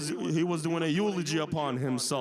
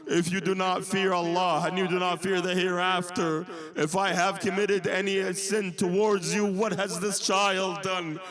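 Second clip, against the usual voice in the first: raised, urgent telling of a sacred tragedy; impassioned sermon narration, pitch climbing; impassioned preaching; 4 semitones higher, 3 dB louder than usual. Acted. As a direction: preach the martyrdom story with mounting intensity.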